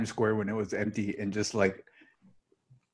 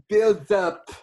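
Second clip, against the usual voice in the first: Russell Brand impression; 9 semitones higher, 6 dB louder than usual. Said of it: "Russell Brand impression".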